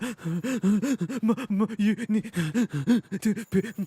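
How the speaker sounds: put-on high, loud blubbering kid voice; little kid crying. Adult calls mum to pick him up, mock crying. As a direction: stammer through sobs like a small child. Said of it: "like a little kid again".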